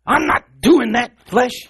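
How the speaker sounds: high, loud, slow refusal to the flesh; firm self-rebuke. Retold or imitated. Minister scolding her own flesh, loud and slow. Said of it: "she deals with herself".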